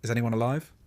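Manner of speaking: loud searching call, rising at the end. A narrator's reading. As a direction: call out for survivors. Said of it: "A voice calls out".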